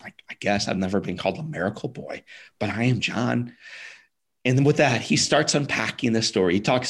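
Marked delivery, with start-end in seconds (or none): 0.0-3.5 soft, half-whispered reply; a timid boy barely answering; shy whisper. Retold or imitated. Nine-year-old boy answering the kneeling doctor in a whisper.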